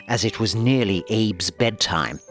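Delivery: ordinary delivery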